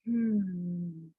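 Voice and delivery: soft, low hum out through the nose; slow nasal exhale. Shown as a demonstration. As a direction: breathe out slowly through the nose. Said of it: "exhale slowly through your nose".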